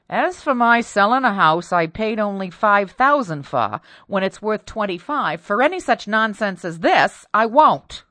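loud, indignant; stubborn refusal. Acted. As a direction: dig in your heels.

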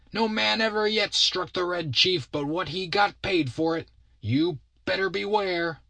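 put-on very high boy's voice, declaiming; boastful threatening kid. Kid warns Bill who boxed his ears, high menacing voice.

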